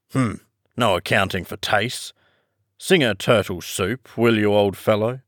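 usual speaking voice

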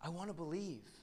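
a hint of a desperate son; retold cry. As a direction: son pleading with his father, lightly voiced. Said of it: "he screams at his dad".

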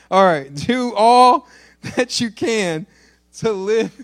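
trying to read but cracking up; preacher losing it with laughter mid-verse; laughing. Real, not acted. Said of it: "Y'all, we're losing it".

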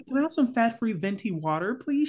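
high, put-on ordering voice; mock coffee-shop customer. Mimicking a Starbucks customer.

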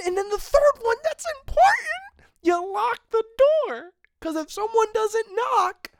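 comic indignant rant; worked-up rant. Real, not acted. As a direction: keep the outrage climbing. Heated rant about bathroom doors.

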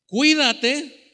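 loud, high call-out; big projected scripture reading; strong reading voice. Shown as a demonstration. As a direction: lead the congregation loudly into the verse. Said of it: "Vamos a leer con voz fuerte".

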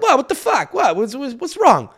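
high-pitched, bewildered retelling; baffled protest. Retold or imitated. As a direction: act out a puzzled "what did I do?". Acts out baffled reaction to looks.

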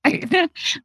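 a short laugh, high and teary; a small emotional laugh. Real, not acted. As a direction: laugh off the tears. Moved, laughing at own emotion.

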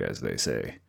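very low, quiet impression voice; Joe Turkel. Podcaster quoting a stock phrase to a co-host, Joe Turkel impression. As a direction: drop deep and soft, as if impersonating someone. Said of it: "That was my Joe Turkel voice".

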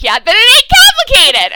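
high, loud put-on voice; hammed-up blunt comeback; mock gruff retort. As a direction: snap back like a fed-up friend. Diggle's blunt retort retold.

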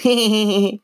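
high-pitched evil dummy giggle; high, rapid "hee-hee" giggle. A narrator's reading. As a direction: giggle like a creepy puppet. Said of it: "He giggled".

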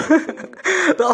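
bright burst of laughter, high up; high amused laugh. Real, not acted. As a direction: laugh at the prank on screen. Amused by livestream prank.

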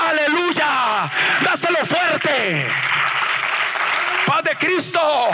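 high, shouted, driving pulpit proclamation; full-force preaching. Real, not acted. Preacher proclaiming miracles and calling for applause, full-force preaching. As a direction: drive the congregation on in a high shout.

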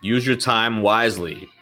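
usual speaking voice

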